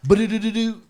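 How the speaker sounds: high-pitched nonsense scat of a stock tune; imitated jingle. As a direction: toss off a quick mock montage riff. Mimics a formulaic montage tune.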